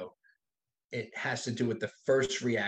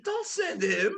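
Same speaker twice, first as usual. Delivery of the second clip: higher, louder, through laughter; giggling retold retort; laughing while quoting; 10 semitones higher, 6 dB louder, much slower than usual. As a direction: crack up as you deliver the line.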